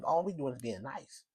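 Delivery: usual speaking voice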